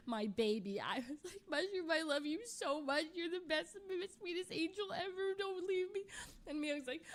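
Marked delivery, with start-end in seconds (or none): 1.5-6.0 a touch of crying, higher pitch; tearful pleading. Retold or imitated. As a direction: plead with the pet through tears. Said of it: "I was sobbing".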